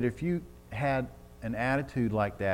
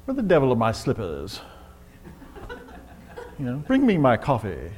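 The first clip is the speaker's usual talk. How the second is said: lightly voiced bossy professor; a hint of a demanding master; Henry Higgins. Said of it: "Professor Henry Higgins".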